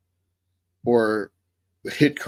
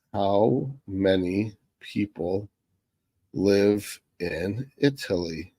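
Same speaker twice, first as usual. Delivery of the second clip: slow dictation; much slower than usual. Real, not acted. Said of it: "typing query slowly aloud".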